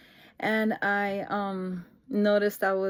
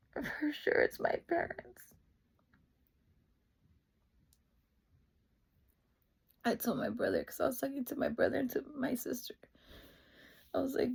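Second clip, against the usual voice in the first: choked up, mostly high and soft; real tearing up; 6 semitones higher, 7 dB quieter than usual. Real, not acted. Recalling parents arriving mid-call, real tearing up. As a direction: push softly through rising tears. Tearing up while telling the story.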